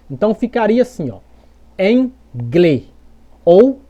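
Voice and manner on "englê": angle said "englê", closed final E; read as Portuguese. Shown as a demonstration. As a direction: bridge G and L into "glê". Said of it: "em português".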